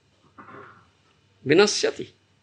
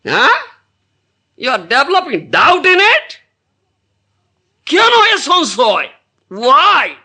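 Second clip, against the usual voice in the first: scolding; 6 semitones higher, 5 dB louder than usual. Acted. Scolding the wicked mind.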